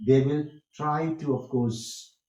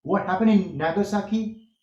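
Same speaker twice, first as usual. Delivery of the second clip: "What happened in Nagasaki" pitched up as a question; higher, questioning tune through "Nagasaki"; question intonation; 5 semitones higher, 3 dB louder than usual. Shown as a demonstration. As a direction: lift the voice to ask about "Nagasaki". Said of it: "Question. The sound should go up".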